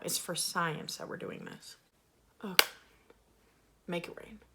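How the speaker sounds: normal talking voice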